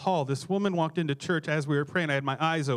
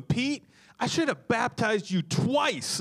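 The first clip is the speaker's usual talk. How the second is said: high, slow teasing jab; mock exasperated pastor; 9 semitones higher, slower than usual. Pastor teasing angry Pete after baptizing him, high mock exasperation. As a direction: rib him like a fed-up pastor. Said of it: "I remember saying to him".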